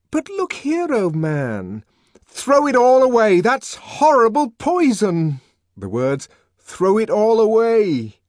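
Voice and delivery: very high, very loud urging; impassioned shout. A narrator's reading. Savage urging the deltas to throw soma away, shouting. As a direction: fling the words at the crowd.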